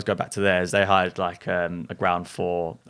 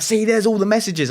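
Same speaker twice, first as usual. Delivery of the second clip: much higher, louder, triumphant; manic insistence; 12 semitones higher, 6 dB louder than usual. Retold or imitated. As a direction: point at the proof, sure of it. Friend points at blank phone.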